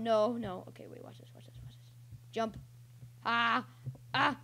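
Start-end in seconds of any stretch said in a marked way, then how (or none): 0.7-1.9 very quiet, whispery repeated aside; hushed muttering. Real, not acted. Player about to try a move, hushed. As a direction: murmur it under your breath. Muttering while concentrating on play.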